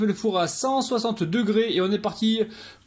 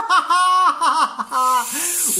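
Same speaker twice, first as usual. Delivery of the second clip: breathless laughing; 9 semitones higher, 6 dB louder than usual. Real, not acted. Laughter continues.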